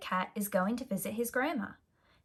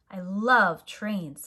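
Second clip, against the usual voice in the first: excited; 8 dB louder, much slower than usual. A narrator's reading. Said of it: "says Pete".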